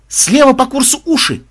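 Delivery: high, loud call of alarm; sudden alert. A narrator's reading. Melisa spotting ears ahead, startled high call. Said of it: "вдруг встрепенулась Мелиса".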